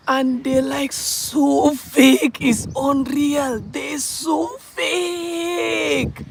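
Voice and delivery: high, long stretched vowels; slow, high cry of disbelief; exasperated wail. Real, not acted. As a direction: drag each word out high in exasperation. Exasperated at people's fakeness.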